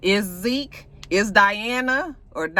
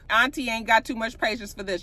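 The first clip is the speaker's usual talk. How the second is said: ordinary talk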